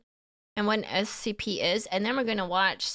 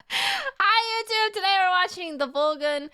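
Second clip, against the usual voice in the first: peppy YouTuber intro; 10 semitones higher, 4 dB louder than usual. Acted. Redoing intro as bright host.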